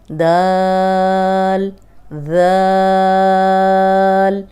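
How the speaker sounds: each Arabic letter name called out loud, long letters held very long; loud, stretched tajweed letter drill; drawn-out letter recitation. Shown as a demonstration. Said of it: "پہلے والے lesson دہرا لیتے ہیں".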